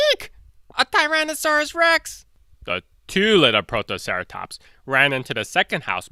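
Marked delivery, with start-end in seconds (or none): 0.1-2.3 high eek of cartoon fright; squeaky scared cry. Second Protoceratops spots the T-Rex, high shriek. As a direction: shriek it like a tiny startled dinosaur.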